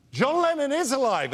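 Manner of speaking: high-pitched mock indignation; indignant insistence. Imitating [name] contradicting the friend, indignant. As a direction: insist against all objection.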